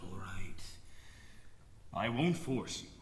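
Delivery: very low, hushed resignation; quiet, heavy surrender; subdued giving in. Acted. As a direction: relent softly, deep in the voice. Father gives in to plea.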